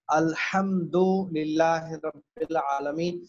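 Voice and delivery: slow, measured الحمد with pharyngeal ح; careful model recitation, long vowel in العالمین; correct pronunciation. Shown as a demonstration. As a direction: articulate الحمد deliberately, ح from the throat. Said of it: "یہ صحیح ہونا چاہیے".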